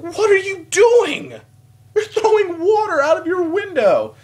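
re-enacted high, loud holler; incredulous yelling. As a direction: drag out the outraged shout at her. Acts out yelling at the lady.

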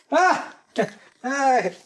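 sudden high yelp of alarm; startled cry. Acted. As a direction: jolt as if caught out. Realizes he has her phone.

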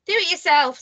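stroppy answer back; defiant retort. A narrator's reading. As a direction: refuse with cheek. Mister Cheeky talks back.

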